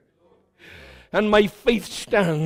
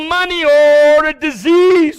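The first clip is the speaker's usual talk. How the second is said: higher and louder, pressing; altar call preaching; 6 semitones higher, 4 dB louder than usual. Acted. Pastor urges the struggling to come forward, louder and higher. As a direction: urge them forward.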